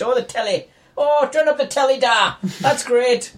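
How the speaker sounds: sing-song stage Irish about the telly; squeaky mock Irish delight; mock Irish villain. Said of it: "he actually says telly".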